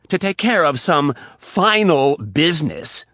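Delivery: loud, slow mocking quote of his words. Riley mocking Rapp's excuse, loud and slow. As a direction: sneer his phrase back. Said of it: "mocked him by making quotation marks".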